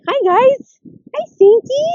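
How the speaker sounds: high, loud sing-song hello to the dogs; high dog-greeting voice. Real, not acted. Owner greeting her dog and neighbor dogs, high loud baby talk.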